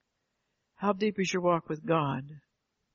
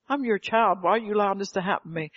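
higher, louder plea to God; protesting believer; 3 semitones higher, 3 dB louder, faster than usual. Acted. Believer questioning God about suffering, protesting plea. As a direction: cry out a hurried protest.

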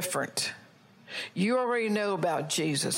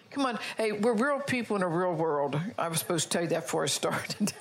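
usual speaking voice

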